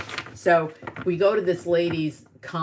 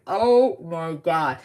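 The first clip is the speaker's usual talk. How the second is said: drawn-out, louder retold gasp of dismay; mock horror; 5 dB louder, much slower than usual. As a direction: stretch it out in mock shock. Retold horror at mom's accident.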